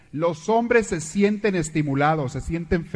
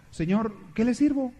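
polite waiter; 8 semitones higher than usual. Acted. Waiter asks in joke.

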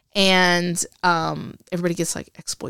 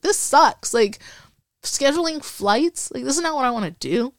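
whiny disappointment; 6 semitones higher than usual. Retold or imitated. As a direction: sulk about a boring job. Venting disappointment privately.

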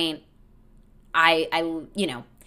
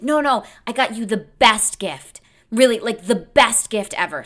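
eager boast; 5 semitones higher, 5 dB louder than usual. Retold or imitated. Boyfriend bragging about his gift.

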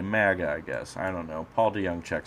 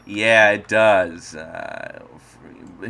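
ordinary talk

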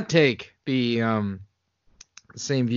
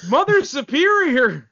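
indignant correction; 10 semitones higher than usual. Pretend. Nun correcting a guest on her title, mock indignant. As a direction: insist on the title like an offended nun.

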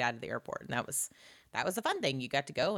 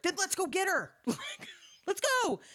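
high, eager mock cheer. Roommates eager to fetch Mary, high excited mock voice. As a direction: jump at the chance, much higher.

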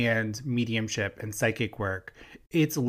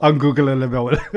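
laughing; 8 dB louder than usual. Real, not acted. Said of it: "I just needed a moment for ungoogleable".

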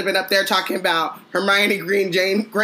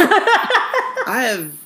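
mock therapy confession; 8 semitones higher, 4 dB louder than usual. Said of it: "and be like".

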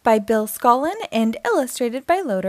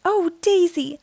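concerned cry; 7 semitones higher than usual. Acted. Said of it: "cries Minnie".

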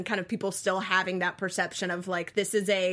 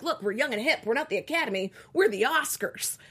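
put-on young and hip voice; 5 semitones higher, 3 dB louder than usual. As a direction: play it like an old institution trying to be hip. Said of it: "That was the young and hip voice?".

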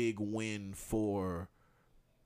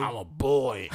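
deep voiced child; 3 semitones higher, 8 dB louder, slower than usual. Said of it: "he had a deep voice for a kid".

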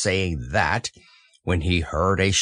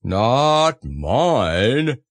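drawn-out, mildly proud donkey; understated donkey pride; proud Eeyore; 3 dB louder than usual. A narrator's reading. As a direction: declare it with heavy satisfaction. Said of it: "said Eeyore proudly".

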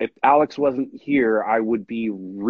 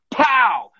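imitated gunshot; 11 semitones higher, 4 dB louder than usual. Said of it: "And he goes".

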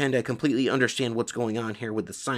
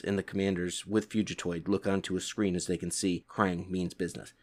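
ordinary delivery

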